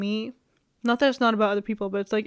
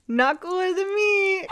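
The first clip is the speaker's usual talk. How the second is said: pitched way up, half laughing, slow; high squealed repeat; 9 semitones higher, slower than usual. Real, not acted. Vlogger repeating her boast in a high giddy squeal.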